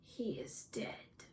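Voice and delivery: very slow, whispery, flat delivery. A narrator's reading. Mother finding her grieving son with the corpse, flat. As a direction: say it softly and slowly, without feeling. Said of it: "in her flat voice".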